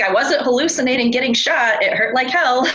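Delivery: emphatic retelling. Retold or imitated. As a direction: insist it was real, a touch indignant. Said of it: "He's like".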